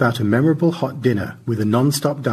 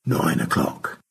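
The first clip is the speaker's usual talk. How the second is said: mouthed; 5 semitones lower, slower than usual. A narrator's reading. Stanley secretly confirming the time to Margaret, whispered mouthing. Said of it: "He mouthed".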